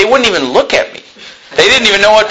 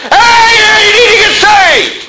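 high, loud mock shout, stretched out; mock street preacher yell; 4 dB louder, slower than usual. Mocking street preachers: a high, loud mock yell.

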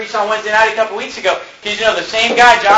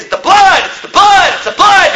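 high, loud cry of the blood; shouted quote; 11 semitones higher, 3 dB louder than usual. Retold or imitated. Preacher quoting the Bible to his church, loud shout. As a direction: belt it out, each one higher. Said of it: "He says".

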